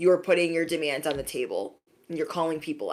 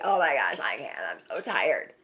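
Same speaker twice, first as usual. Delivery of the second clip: mock tired whine; 6 semitones lower, 5 dB quieter than usual. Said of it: "they're just like".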